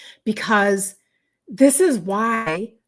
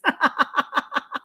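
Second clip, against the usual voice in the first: real amused giggle; higher-pitched run of chuckles; laugh; 6 semitones higher than usual. Real, not acted. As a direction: laugh at yourself. Laughs at her own rambling.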